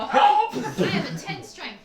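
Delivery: loud boast. Acted. Boasts of strength.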